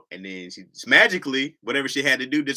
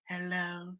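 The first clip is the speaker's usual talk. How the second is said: soft, drowsy drawn-out hello; sleepy voice; 8 semitones higher, 12 dB quieter than usual. Retold or imitated. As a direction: imitate someone just woken up. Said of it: "I hate the sleepy voice".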